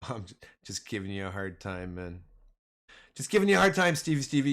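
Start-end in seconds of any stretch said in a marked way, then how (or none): 3.1-4.5 higher and louder, teasing; playful louder tease. Real, not acted. Streamer teasing a viewer, louder and playful. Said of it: "you know I love you".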